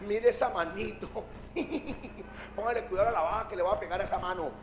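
soft, low giggling taunt; hushed, laughing mockery; mocking waves. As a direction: snicker scornfully at the little hand. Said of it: "las olas dicen".

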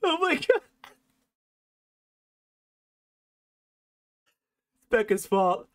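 high, laughing apology; trying to apologize while still cracking up; real giggles. Real, not acted. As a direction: gasp it out between laughs. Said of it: "have the giggles and I can't".

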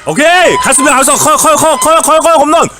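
shouting home run call. Real, not acted. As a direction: belt out the home run, high and loud.

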